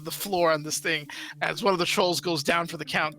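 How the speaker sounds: ordinary talk, nothing marked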